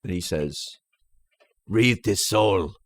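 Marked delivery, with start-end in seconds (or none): 1.7-2.7 slow action-hero impression; Jean-Claude Van Damme. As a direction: imitate the Belgian film star, slowly. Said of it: "very good Jean-Claude Van Damme impression".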